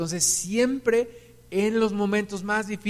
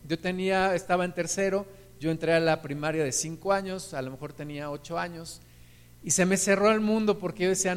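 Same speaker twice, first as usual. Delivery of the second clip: usual speaking voice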